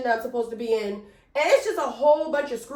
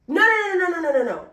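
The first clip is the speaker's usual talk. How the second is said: high, loud burst of no's tumbling downward; alarmed self-correction; 5 semitones higher, 4 dB louder than usual. Real, not acted. Storyteller realizing she told the story wrong, alarmed self-correction. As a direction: cut yourself off with a rapid string of no's. Catches her own storytelling mistake.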